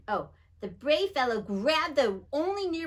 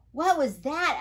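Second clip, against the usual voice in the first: nervous; 6 semitones higher, 6 dB louder than usual. A narrator's reading. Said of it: "asked Little Joe nervously".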